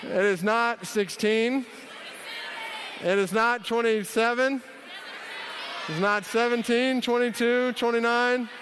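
emphatic no to the audience. Real, not acted. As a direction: call it out over the room. Rejecting shouted audience answers.

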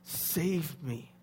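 halting plea, word by word; retold cry for help. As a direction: imitate a desperate prayer, pausing between words. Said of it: "He cried out and said".

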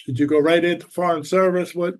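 ordinary talk, nothing marked

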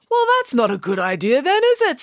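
high, emphatic schoolboy character voice; Binky. A narrator's reading.

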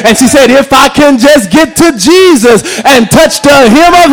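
rising, rhythmic preacher's cadence; full-force preaching. Acted. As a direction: preach it to the congregation with fire. Preaching the gospel story aloud.

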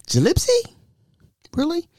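high, disbelieving Gillespie?; incredulous. Acted. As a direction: scoff at the name in disbelief. Mocking the mispronunciation.

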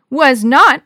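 loud, punchy contradiction; argumentative. A narrator's reading. Pitcher contradicts her brother, arguing loudly. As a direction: fire back in a squabble. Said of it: "Maria argued".

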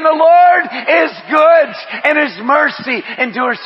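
second take shouted to the congregation. Shown as a demonstration. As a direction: lean in hard and stretch it out. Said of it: "you gotta say it again".

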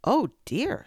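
high, loud alarmed gasp of words; suddenly scared of the tigers; frightened cry. A narrator's reading. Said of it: "said Sean".

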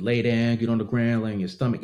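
usual speaking voice

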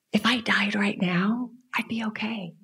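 quiet, breath-light inner thought; hushed reflection. Retold or imitated. Woman confiding what she felt on the plane, hushed. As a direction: softly confide it, trailing into near-whisper. Recalls a hushed private feeling.

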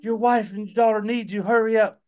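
high, fast pleading nurse voice; urgent nurse. Retold or imitated. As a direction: hurry him back, flustered. Nurse urging father back.

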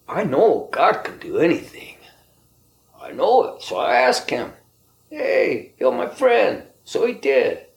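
high, fervent declaration of faith. Real, not acted. Speaker testifying that God can heal, high and fervent. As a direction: lift the voice high with conviction.

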